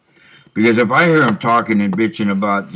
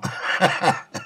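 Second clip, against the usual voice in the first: short breathy chuckle; quick quiet self-amused laugh; 8 semitones higher, 6 dB quieter than usual. Real, not acted. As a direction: laugh softly at yourself. Amused at his old monster-movie sound.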